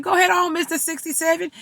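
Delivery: high, loud, admiring egging-on; cheering him on. Retold or imitated. Retold cheering on of the helper.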